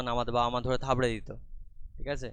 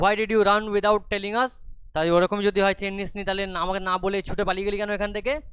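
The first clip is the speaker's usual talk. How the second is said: scolding demand; 7 semitones higher, 6 dB louder than usual. Acted. Mother scolding the boy.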